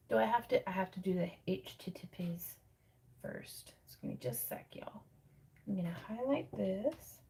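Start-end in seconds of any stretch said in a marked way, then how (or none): 0.7-5.0 hushed muttering while working; whispering to herself. Real, not acted. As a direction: whisper it under your breath. Muttering while fixing the link.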